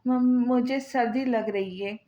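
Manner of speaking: ordinary delivery, nothing marked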